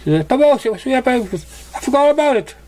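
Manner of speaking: light up at the decorations; perky Pinkie. A narrator's reading. Pinkie spotting the party decorations, squealing with excitement. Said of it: "she perked up when she saw decoration".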